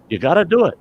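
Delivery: high, loud urging; insistent. Acted. Doctor orders reluctant Tonto back to help, high loud insistence. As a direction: urge the reluctant helper firmly.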